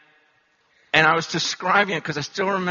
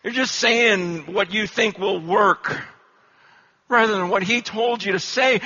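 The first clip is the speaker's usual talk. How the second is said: usual speaking voice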